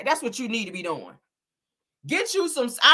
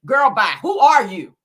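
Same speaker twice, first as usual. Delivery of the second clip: louder, sassy brush-off; 4 semitones higher, 8 dB louder than usual. Real, not acted. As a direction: call her out, who are you. Dismissing a critic, louder.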